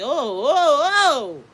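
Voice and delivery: exaggerated startled ohs; mock alarm. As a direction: react as if backing off from a tantrum. Mock alarmed reaction to outburst.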